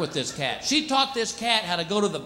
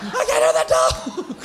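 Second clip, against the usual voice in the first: mock deaf yell; 11 semitones higher, much slower than usual. Visitor pretending a barkless dog deafens him, hammed-up yell. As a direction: shout as if you can't hear. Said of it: "You're like".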